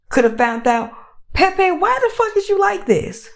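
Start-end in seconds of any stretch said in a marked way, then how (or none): 1.3-3.3 high-pitched, exasperated questioning; exasperated confrontation. Acted. Someone confronting Pepe over his behavior, exasperated. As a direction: demand an explanation in disbelief.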